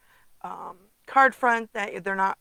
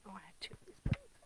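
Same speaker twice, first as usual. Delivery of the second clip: whisper; 14 dB quieter, faster than usual. Real, not acted. Muttering to herself while crafting.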